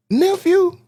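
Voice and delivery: very high, stunned "Nephew?"; shocked disbelief. Retold or imitated. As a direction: uncle can't believe who is at the door. Uncle recognizes nephew, shocked.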